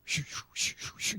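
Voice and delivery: unvoiced shoo like a washing machine; preacher imitating his heart murmur, whispered whoosh; whooshing heartbeat. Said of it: "mine was going".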